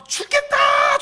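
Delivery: high, drawn-out shout; exclaimed outburst. Acted. Preacher mimicking words that slip out by habit, shouted. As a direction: cry it out high as a habitual complaint.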